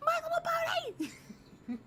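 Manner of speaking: laughing excited squeal. Real, not acted. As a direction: shriek it with laughing delight. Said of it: "I'm not trying to, like, yell in your guys' ear".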